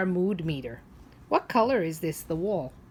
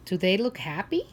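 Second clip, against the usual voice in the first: ordinary delivery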